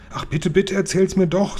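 begging, pitched well up; wheedling plea for a story; pleading. Acted. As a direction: coax eagerly, high and insistent. Child begs for the story.

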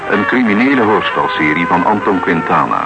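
usual speaking voice

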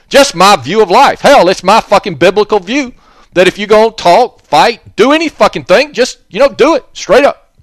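high, loud, building rant; heated rant. Real, not acted. Ranting to listeners about fighting straight up. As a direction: preach it straight with rising heat.

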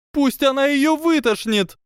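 very high, loud shrieking demand; hysterical wail. Acted. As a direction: howl it hysterically. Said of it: "завопила Панси". (истеричный вопль, очень высоко)

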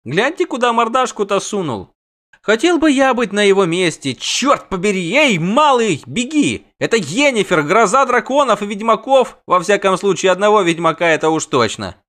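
high, loud jeering bard; rowdy bard. Acted. Dandelion jeers at Yennefer and the dragon, loud and high. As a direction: crow it out loud, mocking. Bard's loud mocking banter.

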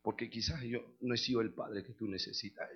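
low, very soft apology; padre lloroso imitado. Retold or imitated. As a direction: voice a tearful father admitting his failing. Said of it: "se pone a llorar y dice". (padre arrepentido imitado, en voz muy baja)

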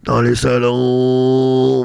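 slow, put-on skeptical doorman. Doorman questioning the visitor, slow and doubtful. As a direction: drag it out, unimpressed.

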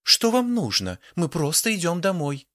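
high, theatrical cry; theatrical exclamation. A narrator's reading. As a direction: overplay the frightened lady. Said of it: "несколько театрально воскликнула".

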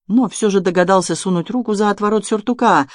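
ordinary delivery

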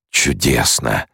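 mildly voiced delight, breathed out; rapturous exhale. A narrator's reading. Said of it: "восторженно выдохнул Скитали".

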